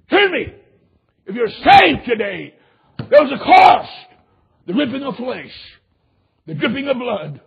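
impassioned pulpit shout; full-force preaching. Real, not acted. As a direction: drive each phrase out loud, then pause.

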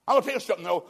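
I know all about it, rising to a shout; preaching loud. Real, not acted. Preacher to the congregation, loud outcry.

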